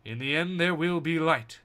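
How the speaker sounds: epic storyteller voice, building to proclamation; dramatic narration. A narrator's reading. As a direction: read it like a grand comic narrator.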